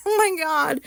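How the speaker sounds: high laughing gasp breaking into the words; real laugh. Real, not acted. Recalling her embarrassing dentist visit, laughing. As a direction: laugh it out, half crying.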